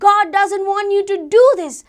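loud, high, firm refusal; bold. Acted. Boy facing the gunman to shield captain, bold and loud. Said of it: "very boldly and saying".

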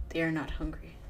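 ordinary talk, nothing marked

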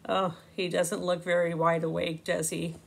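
ordinary delivery, nothing marked

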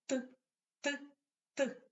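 short isolated "Т", three separate taps; hammer sound "Т". Shown as a demonstration. Teacher leading children in repeating the hammer's knock, separate "Т" taps. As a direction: knock out "Т" with a pause after each. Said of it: "Як стукає молоток?".

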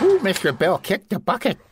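normal talking voice

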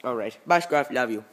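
ordinary talk

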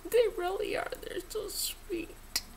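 high soft whispered gush; gushing coo. Real, not acted. Praising friends to viewers, whispered gush.

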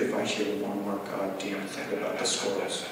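low, quiet acted seething; pure hatred. Meth head to himself about squirrel jokes, low quiet hatred. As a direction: imitate hatred kept under the breath. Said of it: "says with pure hatred".

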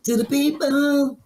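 high, slow announcer call. Shown as a demonstration. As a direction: boom it out like a hype man. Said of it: "just, uh, say hello, [name], to the people".

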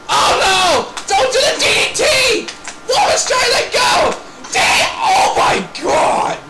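high, frantic commentator shouting; alarmed commentary. Pretend. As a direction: cry out in horror at the move. Reacting in alarm to a wrestling move.

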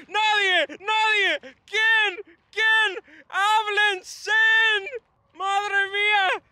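high, loud wails word by word; anguished shouting. Real, not acted. Fan appalled that nobody finished the chance, anguished shouting. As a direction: howl each word in disbelief, stretching it out.

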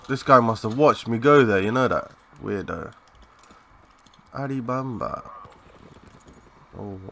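ordinary delivery

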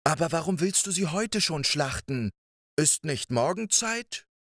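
the wife gently trying to delay the giant; wife's voice. Acted. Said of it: "als seine Frau sagte". (bittende Frauenstimme)

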